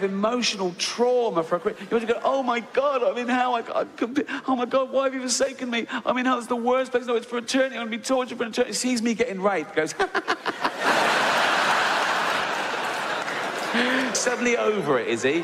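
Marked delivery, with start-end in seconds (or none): 2.2-8.7 hammed-up horror at waking in hell; mock panic. 10.0-14.1 breathless high cackle; high wheezing laugh. Retold or imitated.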